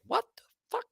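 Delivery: jumps way up high in a squeaky mock shock; a retold, high-pitched what-the-hell moment; shocked disbelief. Finding a mess in the kitchen.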